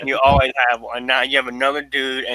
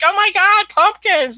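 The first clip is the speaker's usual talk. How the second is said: squealed way up high and a bit louder; mock girly squeal; 5 dB louder, slower than usual. Girlfriend squealing over pumpkins, hammed-up excitement. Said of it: "He's like".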